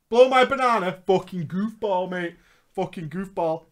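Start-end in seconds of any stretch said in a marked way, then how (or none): none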